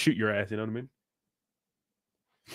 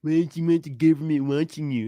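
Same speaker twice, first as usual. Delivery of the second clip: mocking a dumb argument; 3 semitones higher than usual. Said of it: "smooth brain argument".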